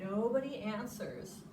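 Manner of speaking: ordinary delivery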